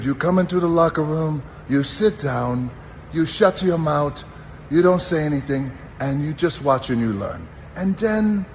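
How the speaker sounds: put-on an old-timer's Iranian-accented locker room lecture; Iron Sheik accent. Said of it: "he had this great accent".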